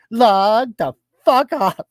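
slow, drawn-out mock put-down; exaggerated imitation of a snippy commenter; mocking parrot.

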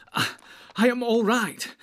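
high, loud, broken gasp. A narrator's reading. Hurt hobbit reassures his rescuer, gasping. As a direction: gasp it out haltingly. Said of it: "gasped Frodo".

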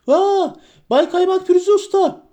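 very high, excited yell; high-pitched shout of surprise; astonished cry. A narrator's reading. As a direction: shriek for the master in amazement. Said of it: "hayretle bir çığlık attı".

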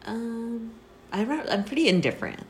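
ordinary talk

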